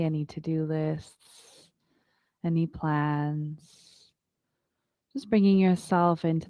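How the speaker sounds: calm, unhurried yoga teacher voice; guided meditation. Acted. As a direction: guide the class into stillness. Leads a yoga breathing meditation.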